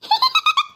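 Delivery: very high, sudden shriek of a laugh; a quick ghostly cackle; short, piercing wicked laugh; evil cackle. Acted. Ghost laughs behind mother's back.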